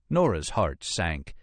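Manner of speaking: ordinary delivery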